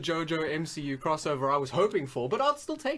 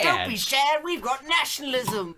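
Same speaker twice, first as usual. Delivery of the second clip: higher hammed-up German voice; mock Hitler; 5 semitones higher than usual. Joking to Jojo, higher mock Hitler voice. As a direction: play the silly Hitler, lightly German.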